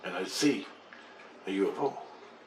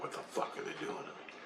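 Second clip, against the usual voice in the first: 1 exasperated mutter; 14 dB quieter than usual. Retold or imitated. Frustrated muttered question.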